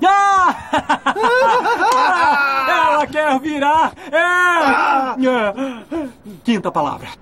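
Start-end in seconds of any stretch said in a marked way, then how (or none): 0.1-0.5 high loud startled cry; a sudden high yelp of shock. Acted. Priest reacting to the embarrassing guess, startled yelp. 0.6-2.9 shouting laugh. Acted. 2.9-5.2 excited shout. Acted. Groom shouting his guess at the priest, excited.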